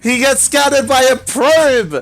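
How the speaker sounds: high, loud, urgent match call; excited play-by-play shout. Real, not acted. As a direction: shout the sudden turn to the crowd. Commentator reacts to probe scouting.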